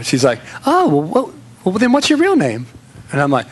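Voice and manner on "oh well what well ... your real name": higher voiced girl asking questions; light imitation of a curious young woman; mimicking girl. Woman at the bar quizzing him, in a higher girlish voice.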